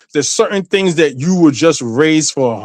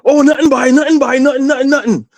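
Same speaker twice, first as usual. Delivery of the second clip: imitated mother, pitched up and dodging; evasive mother; 11 semitones higher, faster than usual. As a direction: brush it off like a caught mother. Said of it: "She's like".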